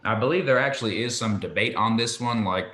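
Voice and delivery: ordinary delivery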